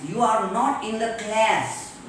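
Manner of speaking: CLASS with front "a" as in cat; American class. Shown as a demonstration. Teacher drilling American example, CLASS with cat vowel. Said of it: "In American English".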